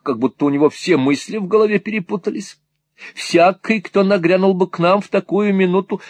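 animated, put-upon lady; aggrieved, garrulous mother. Acted. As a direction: pour out a mother's mild grievance.

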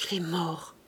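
soft, low, like a breath; low murmur. A narrator's reading. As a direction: let it out as a mere breath. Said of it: "murmura d'une voix basse comme un souffle".

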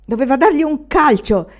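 grido più acuto e forte. A narrator's reading. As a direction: esplode di rabbia, gridando. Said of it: "gridò Anania". (Anania balza in piedi e grida indignato)

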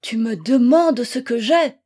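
wild, wounded exclamation; half-crazed retort. A narrator's reading. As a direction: throw the question back, losing control. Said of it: "dont la raison s'égarait".

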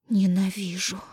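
breathy whispered moan. A narrator's reading. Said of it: "выстонала Даша".

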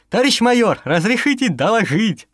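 crisp, eager report to superior; young corporal reporting; eager soldier report. A narrator's reading.

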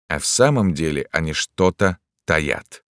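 plain reading voice

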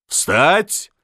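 high, sharp military command; soldier's bark. A narrator's reading. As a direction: bark it like a drill order. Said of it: "рявкнул Миних по-солдатски". (рявкнуть по-солдатски)

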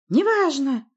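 high, loud cry; shout. A narrator's reading. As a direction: cut in with a high yell. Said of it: "прокричала Власта".

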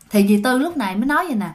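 ordinary delivery, nothing marked

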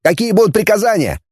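put-on high young patrolman's voice; high-pitched junior officer reporting; eager shouted report. A narrator's reading. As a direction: call out your rank and name, high. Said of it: "выкрикнул он".

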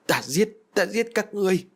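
high-pitched frenzied threat; murderous rage. Acted. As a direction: let the pitch soar as you vow to kill. Sorcerer attacks the shadow, raging.

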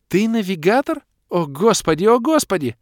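high, loud, breathless-with-awe exclaiming; girl losing it with astonishment; overwhelmed shock. A narrator's reading. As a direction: squeal in total disbelief. Kira stunned, keeps exclaiming.

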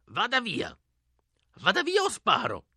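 put-on high-pitched, strained old-woman cry; old woman shouting. A narrator's reading. Old woman to boys behind her door, crying out. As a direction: screech it as a scared old woman, without much volume. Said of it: "gridò con una ciangotta stranamente profonda".

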